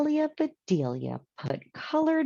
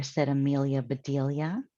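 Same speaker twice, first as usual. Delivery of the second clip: ordinary delivery, nothing marked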